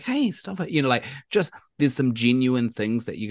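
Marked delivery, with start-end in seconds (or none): 0.0-0.7 high-pitched playful Cho protest; mock a girl telling friends off. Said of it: "she's like".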